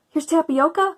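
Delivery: high, eager call from the cupboard; calling out her find with delight; a child's excited little cry. A narrator's reading. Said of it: "cried Tacy from the cupboard".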